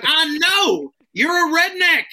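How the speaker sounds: high, loud triumphant yell; punchline yelled up high. Retold or imitated. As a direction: shout it down the bar in triumph. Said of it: "And I was like".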